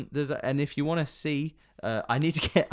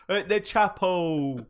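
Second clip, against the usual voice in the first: slow, higher, near-natural British; British accent; 5 semitones higher, much slower than usual. Pretend. Co-host finishing marriage joke, slow mild mock British. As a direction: draw it out in lightly exaggerated British. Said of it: "too close to natural British accent".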